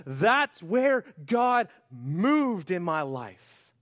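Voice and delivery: loud, slow and high declaration; voicing a believer looking back on God's work; slow testimony cry. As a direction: drag it out in wonder. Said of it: "you're gonna look back and go".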